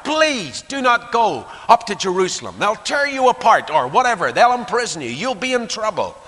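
high, loud anxious plea; pleading. Acted. Friends begging Paul to avoid Jerusalem, pleading. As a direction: implore him to stay away. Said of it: "They're pleading with this man".

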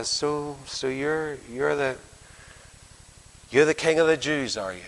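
soft, hesitant, faintly scornful questioning; understated scorn, feeling for the words; touch of scorn. Acted. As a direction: look him over and doubt it quietly. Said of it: "there's a touch of scorn in it".

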